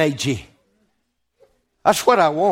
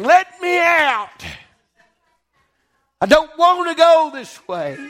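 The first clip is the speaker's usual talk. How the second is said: high, slow, pleading "Let me out."; hammed-up cry against the window; mock protest cry; 8 semitones higher than usual. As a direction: play a trapped soul pleading to get out. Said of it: "we're beating on the window saying".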